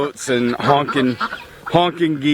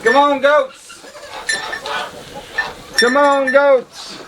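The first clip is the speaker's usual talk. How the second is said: calling animals; 12 semitones higher, slower than usual. Real, not acted. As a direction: call them in across the yard. Calling goats in the pen.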